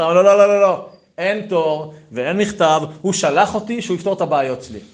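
loud, high string of urgent no's; insistent refusal. Acted. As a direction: cut off the objection forcefully. Refuses to wait or write.

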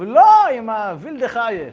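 loud, high, drawn-out protest; dismayed outcry, stretched words; acted parental dismay. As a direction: wail the objection slowly and loudly. Acted distraught parents correcting congratulations.

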